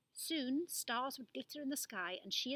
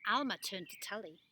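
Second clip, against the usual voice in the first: usual speaking voice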